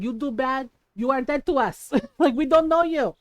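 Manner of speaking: imitated Filipino accent, scolding verdict; Filipino mother. Mom disowning Joe, imitated Filipino-accented scolding. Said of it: "She's like".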